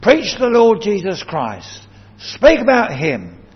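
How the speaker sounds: emphatic preacher's charge; Paul, very emphatic. Retold or imitated. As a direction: urge it forcefully as an order. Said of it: "He was very emphatic".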